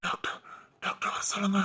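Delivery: struggling for every breath, barely whispering; a dying man begging for the doctor in a weak whisper; breathless dying plea. Acted.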